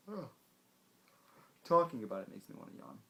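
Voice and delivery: normal talking voice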